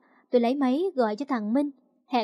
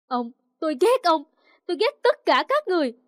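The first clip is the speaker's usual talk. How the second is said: higher, louder hateful cry at father; angry outburst; 4 semitones higher, 5 dB louder than usual. Acted. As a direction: lash out, pitch jumping up. Daughter's outburst at father in quarrel. (hét lên đầy căm giận)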